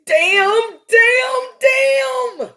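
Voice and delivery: a grieving mother's outburst, pitch climbing to a scream; anguished shout. Retold or imitated. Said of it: "listen to the emotions and the hurt".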